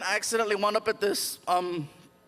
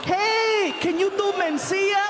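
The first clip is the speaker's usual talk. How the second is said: high, loud, eager request; exaggerated celebrity impression; Paul Rodriguez; 11 semitones higher, 6 dB louder, slower than usual. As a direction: call out in a pitched-up comedian's voice. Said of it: "He was like".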